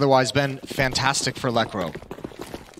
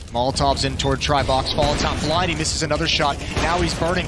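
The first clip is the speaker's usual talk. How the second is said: normal talking voice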